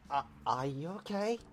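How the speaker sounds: muffled inside a helmet. Acted. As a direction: speak through a closed helmet. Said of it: "sound like a muffled alien with this thing on".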